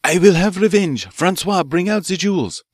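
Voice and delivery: villain losing control; frenzied vow. Acted. Furious villain vowing revenge.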